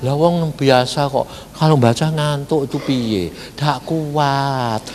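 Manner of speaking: soft, resigned mock excuse; mimicked excuse. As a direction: imitate someone giving up, quietly. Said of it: "Jadi jangan bilang". (menirukan keluhan pasrah, pelan)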